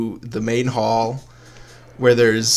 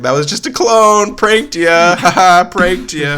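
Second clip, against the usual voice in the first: gloating prank; 5 semitones higher, 4 dB louder than usual. Acted.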